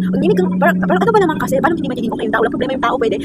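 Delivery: mataas at malakas na inis na tanong; harsh retort. Retold or imitated. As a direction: gayahin ang pabalang na pagsagot ng kaklase. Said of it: "harsh din yung pagkasabi niya". (pabalang na tanong na may inis)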